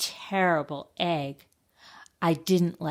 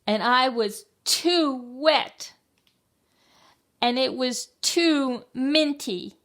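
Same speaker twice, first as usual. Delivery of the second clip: high, loud, drawn-out whine; exaggerated complaint; 8 semitones higher, 5 dB louder than usual. A narrator's reading. Child after her bath, exaggerated complaint. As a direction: stretch and punch each grievance.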